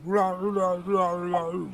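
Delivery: a hammed-up monster going rah, rah; mock monster growl. Player mocking how the monster speaks, in a hammed-up growl. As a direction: bark out rah like a silly beast. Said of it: "it's like".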